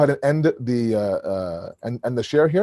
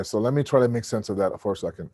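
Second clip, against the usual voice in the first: ordinary talk, nothing marked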